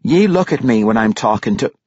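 mild motherly scolding, cut off mid-sentence; a touch of anger from a scowling mother. A narrator's reading. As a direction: scold like a dwarf mother, broken off abruptly. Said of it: "the scowling face of Yuene Roundshield".

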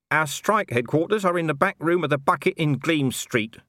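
saunter in unbothered; lightly voiced Nobby. A narrator's reading. Nobby ambling in and teasing Colon, offhand.

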